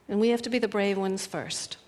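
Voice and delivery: ordinary talk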